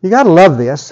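normal talking voice

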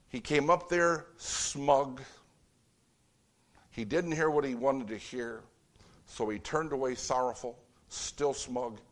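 normal talking voice

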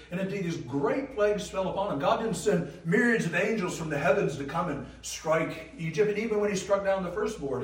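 loud, high, driving sermon delivery; full-force preaching. Acted. Preacher describing the plagues to his congregation, loud and ringing. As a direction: proclaim it like thunder.

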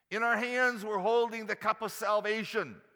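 high, loud preaching swell; preaching at full force. Real, not acted. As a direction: lift it up like a sermon peak. Preaching climax.

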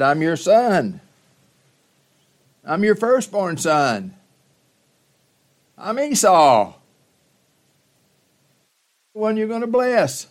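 high, loud, pleading cry of a son; desperate plea. Acted. Esau pleads with his father.

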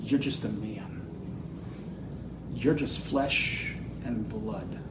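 low, hushed words with long pauses; Jesus's gentle reply. Acted. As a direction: drop low and soft, unhurried, letting each phrase settle. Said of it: "Jesus responds by saying".

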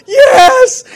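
high, loud triumphant yes; gleeful cheer. Retold or imitated. Owner secretly cheering as the collar works, gleeful shout. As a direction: cheer with mock wicked delight. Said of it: "I'm sitting there like".

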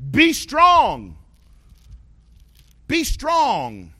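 loud, high call to be strong; loud exhortation. Acted. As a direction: shout it out to the congregation. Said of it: "And he says".

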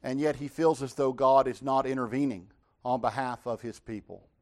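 ordinary delivery

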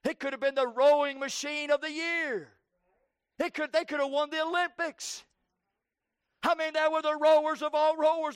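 building preaching. Acted. Preacher building up the rowers to the congregation, rising force. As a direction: preach it up like a revival.

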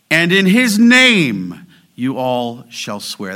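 "Lord your God", "him" and "his name" drawn out strongly, then drop away; slow recitation, weight on "Lord your God", "him", "his name"; stress on front words. Shown as a demonstration. Preacher shows the Hebrew word order, stressing "Lord your God", "him", "his name". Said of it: "That's the literal Hebrew order".

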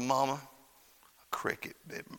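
slow, hushed, deadpan fib; low, drawn-out innocent alibi; straight-faced child's lie. Acted. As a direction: tell mama the lie softly with a straight face. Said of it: "with a straight face as I could".

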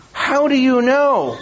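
put-on the boy can't believe it, pitch jumps up; Shasta's astonished cry. Shasta cries out in disbelief.

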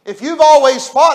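ordinary talk

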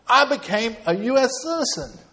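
high-pitched retold big news; Dave's proud announcement. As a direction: imitate a friend proudly announcing, voice lifted high.

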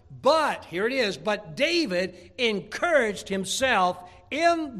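loud, high, slowing on key words; full-force preaching. Acted. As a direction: preach it out to the back row.

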